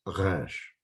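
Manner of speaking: Resh with an unrolled R from the throat; throat-made R. Shown as a demonstration. Teacher correcting away from Spanish, throaty R in Resh. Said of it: "pronounced in the back of your throat".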